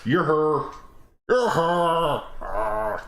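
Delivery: a hint of a character voice; put-on voice. Shown as a demonstration. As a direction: try the line as someone else. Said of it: "repeated line, new voice".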